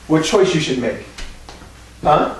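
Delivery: ordinary talk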